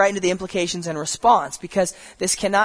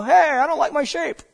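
high mock whiny voice; clay griping to the potter in a high put-on voice; complaining clay pot; 9 semitones higher than usual. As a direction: play a lump of clay grumbling about its shape. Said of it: "You don't sit there and go".

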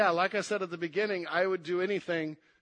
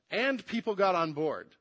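normal talking voice

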